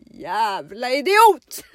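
loud, drawn-out cursing; acted angry insult. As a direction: hurl it slowly and loudly at the boyfriend. Acted insult before slamming door. (högljudd, utdragen svordom)

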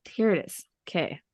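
ordinary talk, nothing marked

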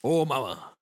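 put-on swaggering cartoon voice; Johnny Bravo. Game master jokes after a missed impression, cartoon ladies' man voice. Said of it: "I think Johnny Bravo".

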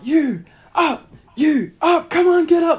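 high, loud, urgent yelling to wake the room; frantic yelling. Retold or imitated. Davo in his dream waking the hostel, frantic high yelling. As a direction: shout them out of bed like the place is burning. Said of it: "like, yelling out".

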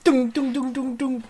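high-pitched ringing metal imitation; imitated clanging. Shown as a demonstration. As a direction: mimic a metal cup bouncing on the floor. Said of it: "Did it make the sound".

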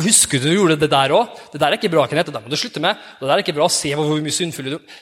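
lightly voiced scolding conscience. Accusing inner voice reminding Kenneth of his sins. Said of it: "Og så minner han på".

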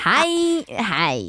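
drawn-out Malmö vowels; imitated Malmö accent. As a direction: stretch it like a Malmö speaker. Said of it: "hon pratar ju så här". (härmad malmöitisk dialekt)

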